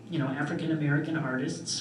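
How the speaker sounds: ordinary delivery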